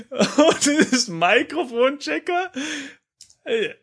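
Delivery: words broken up by laughter; laughing. Real, not acted. Bursts out laughing at the answer.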